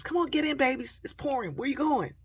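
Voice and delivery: high, loud, urgent invitation; re-enacted call from a car window. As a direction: holler to kids out in the rain. Calls to kids from car window.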